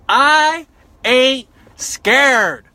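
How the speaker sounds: slow defiant. Real, not acted. Drawn-out defiant line.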